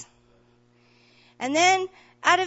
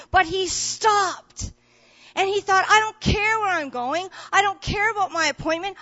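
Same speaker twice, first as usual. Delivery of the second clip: loud, higher, fervent sermon delivery; a rousing, louder preacher's call to care for others; preaching at full force; 6 semitones higher, 8 dB louder than usual. Acted. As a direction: preach it out to the whole church, climbing. Preacher building to a climax.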